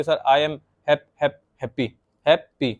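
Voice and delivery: slow, breaking happy into hap, hap; child stammering over happy; syllable stutter. Shown as a demonstration. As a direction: repeat the first syllable of happy. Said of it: "तो इसको दो हिस्सों में repeat करके".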